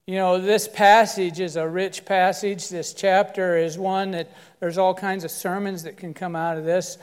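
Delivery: ordinary talk, nothing marked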